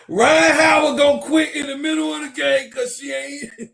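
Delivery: loud, high excited shouting; shouting with glee. Real, not acted. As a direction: yell it over the room.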